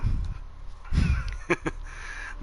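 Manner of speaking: long voiceless chuckle; real laugh. Real, not acted. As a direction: snicker at your own joke. Laughs after his own joke.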